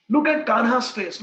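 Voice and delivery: Kanha's pushed out loud. Retold or imitated. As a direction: call it out to make her look. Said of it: "he speaks".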